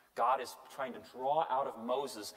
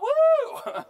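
very high, loud whoop; shrieked mock-celebratory "woo"; mock cheer; 13 dB louder than usual. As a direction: let out a piercing sarcastic whoop. Sarcastic cheer about racism.

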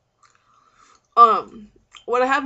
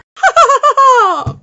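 loud, high burst of laughter; triumphant laugh; 16 dB louder than usual. Acted. Celebrating the best day.

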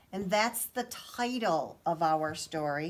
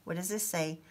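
plain reading voice

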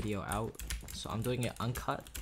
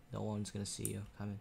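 sneaky quiet; 8 dB quieter than usual. Shown as a demonstration. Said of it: "You have to be very quiet".

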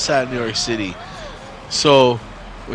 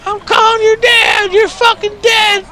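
high, loud furious mother screaming threats; shrill mock mother's tirade; shrieking angry mom; 5 dB louder than usual. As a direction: scream it like a mom who just found out. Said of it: "That's what she would've said".

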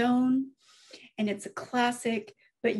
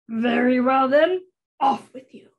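loud, curt troll dismissal; booming storybook troll; gruff troll; 6 dB louder than usual. Acted. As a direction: send them off forcefully, like a troll. Troll dismisses the middle goat.

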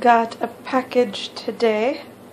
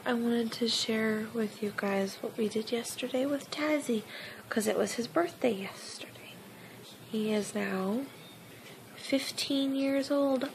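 soft unvoiced whisper; 12 dB quieter than usual. Real, not acted. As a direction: whisper it to the camera. Whispering intro to camera.

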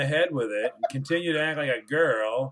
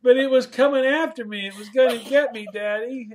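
mock childish whine; 8 semitones higher than usual. Mocking her childish excuse.

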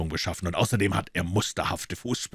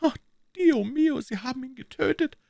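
ecstatic disbelief. A narrator's reading. Woman overjoyed by the body.

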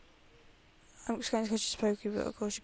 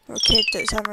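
ordinary talk

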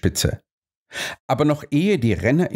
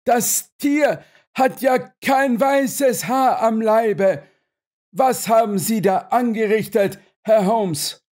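very high, agitated reproach; upset outcry; 11 semitones higher than usual. A narrator's reading. Owner blames Holmes for the wrong horse, crying out. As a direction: protest in a high, stretched voice. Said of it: "rief der Besitzer des Silberstrahl".

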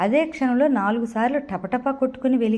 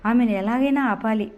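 normal talking voice

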